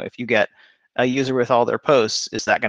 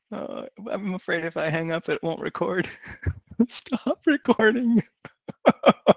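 giggly late night call; 7 semitones higher than usual. Real, not acted.

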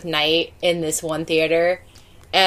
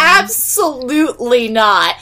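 slow, higher-pitched mock refusal; emphatic refusal; 4 semitones higher, slower than usual. Daughter retells refusing mom's cemetery tour, slow emphatic refusal. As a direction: draw out each word to refuse outright.

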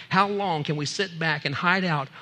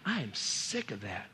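low, hushed, slow rebuke; slow, soft, deep-pitched displeasure; God's weary disgust; 7 semitones lower, 8 dB quieter, slower than usual. Acted. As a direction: drop the voice low and quiet, utterly fed up. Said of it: "And God's saying".

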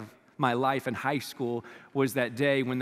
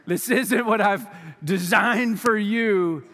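the Lord's voice; 4 semitones higher, 6 dB louder, much slower than usual. Retold or imitated. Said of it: "the Lord was like".

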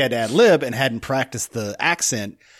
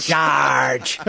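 name bent into a light pirate shout; pirate-like accent. Retold or imitated. Mocking how his accent turns by the end, pirate-like. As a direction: exaggerate the accent slipping towards pirate. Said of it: "It sounds like a pirate".

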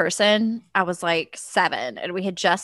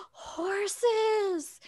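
high, breathless delight at horses; excited child; 11 semitones higher, 6 dB quieter than usual. Retold or imitated. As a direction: squeal it like a horse-mad girl. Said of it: "I was so excited".

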